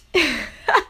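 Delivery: high burst of real laughter; helpless laugh. Real, not acted. Podcaster laughing at a threat just read out, helpless laugh. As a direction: crack up in spite of yourself. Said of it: "I shouldn't be laughing".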